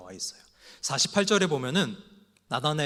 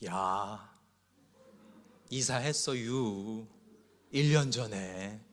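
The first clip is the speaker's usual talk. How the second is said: very slow, lowered Chungcheong dialect; slow Chungcheong drawl; 3 semitones lower, much slower than usual. Pretend. Pastor playing a slow Chungcheong believer, slow drawl. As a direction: stretch it out like a sleepy Chungcheong local. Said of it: "충청도 신자는요".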